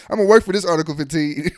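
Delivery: loud line broken up by real laughter; laughing. Real, not acted. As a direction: laugh through the boast. Joking about earning the punishment.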